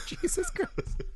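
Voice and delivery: high, breathy laughing gasp of Jesus Christ; laughing exclaim. Retold or imitated. Watching the hell void scene, a high laughing Jesus Christ. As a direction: squeal it through a laugh in disbelief. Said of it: "you're just like".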